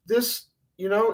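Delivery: ordinary delivery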